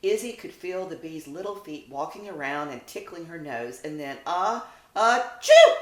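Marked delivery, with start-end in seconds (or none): 4.2-5.8 building gasps into a loud high sneeze; acted sneeze. Izzy the puppy sneezing the bee away. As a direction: gasp twice then burst. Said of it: "sneezes Izzy".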